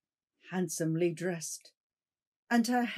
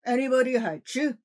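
gruff, louder questions; rough questioning; 3 semitones higher, 5 dB louder than usual. Acted. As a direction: demand an answer roughly. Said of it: "roughly exclaimed".

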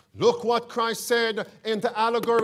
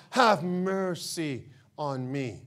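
pleading rich man; 6 semitones lower, 4 dB quieter, slower than usual. Acted. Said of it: "he cried and said".

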